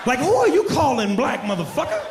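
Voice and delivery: high, loud indignant retort; shouting high and outraged; indignant comeback. Retold or imitated. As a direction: fire back offended. Comeback to African insult.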